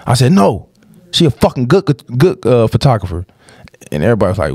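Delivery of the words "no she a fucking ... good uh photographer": sharp, high retort defending his sister; retold snap. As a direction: fire back at him, pitch jumping up. Snapping back at cousin at wedding.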